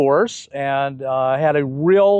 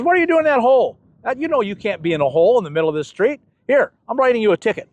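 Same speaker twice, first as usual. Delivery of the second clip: scolding; 6 semitones higher, faster than usual. Retold or imitated. Officer scolds man in hole.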